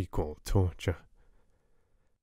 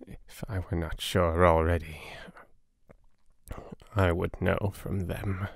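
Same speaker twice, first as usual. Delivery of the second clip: weak dying patient; 4 dB quieter than usual. Acted. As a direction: barely push the words out, pained and confused. Patient whispers, fading.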